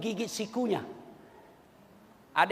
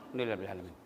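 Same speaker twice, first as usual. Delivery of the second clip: Alhamdulillah Rabbil Alamin swallowed, much softer and rushed; mumbled; 14 dB quieter, much faster than usual. Shown as a demonstration. Careless worshipper mumbling thanks, much softer and rushed. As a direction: mumble it fast and low. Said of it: "Jangan kau bilang".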